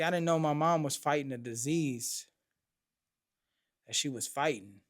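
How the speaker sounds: usual speaking voice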